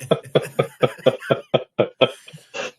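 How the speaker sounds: long breathy burst of laughter; hearty laugh. Real, not acted. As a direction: let a big laugh roll out. Big laugh after story.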